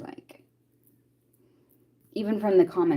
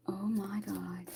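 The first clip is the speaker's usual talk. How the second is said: hushed, voiceless shock; barely-there astonished whisper; stunned whisper; 14 dB quieter, slower than usual. Real, not acted. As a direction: whisper it in disbelief. Startled by her own slip.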